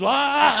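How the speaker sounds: high, loud startled "ah"; mock scream. Storyteller grabbing her head as the wig comes, mock scream. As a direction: scream as if the thing came off in your hand.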